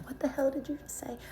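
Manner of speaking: high-pitched retold disbelief; incredulous. Nurse hearing about two sisters in labor, incredulous.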